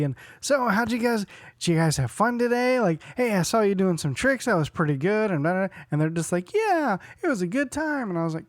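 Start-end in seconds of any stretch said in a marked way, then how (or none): 0.4-5.3 put-on high, upbeat small talk with kids; put-on cheer. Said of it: "trying to make things happy". 6.5-8.1 high-pitched mock kid reply; imitated kids. Acting out the boys' reply.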